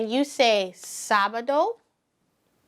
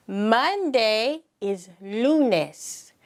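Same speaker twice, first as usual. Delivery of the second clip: slow, clear Monday is lunes; slow model; much slower than usual. Shown as a demonstration. Teacher modelling lunes slowly for the class. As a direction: spell it out for learners. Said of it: "Can you say lunes?".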